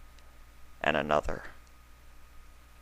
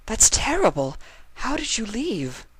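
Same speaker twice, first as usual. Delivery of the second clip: higher voice, pure shock; the mare aghast, higher; higher Octavia, shocked. Acted. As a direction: voice Octavia in dismay.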